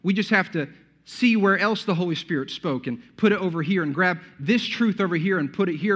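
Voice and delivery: usual speaking voice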